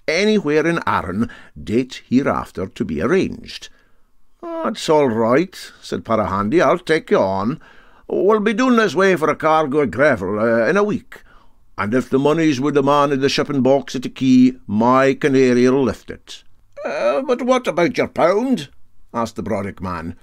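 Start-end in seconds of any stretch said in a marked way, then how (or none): 4.4-16.4 put-on raised, hearty old skipper voice; Para Handy's voice. Captain taking up the bet, hearty and raised. 16.8-18.7 much higher, pointed question; a sceptical local pressing him; Brodick man asking. Acted. Brodick man challenging the captain, high and pointed.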